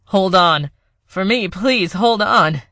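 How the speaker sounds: voice cracking. Acted. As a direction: beg her, voice breaking. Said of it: "Ellis's voice cracked".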